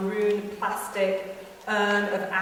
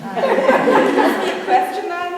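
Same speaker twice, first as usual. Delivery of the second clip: laughing through the words; loud, slow, laughing it out; 9 semitones higher, 10 dB louder, slower than usual. Retold or imitated. Laughing while quoting girl.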